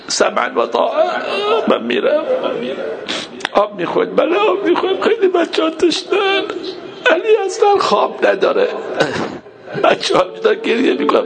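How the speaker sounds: high wail through sobs; weeping lament. Real, not acted. Preacher voicing Abbas going for the children's water, weeping wail. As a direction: keep narrating while crying hard.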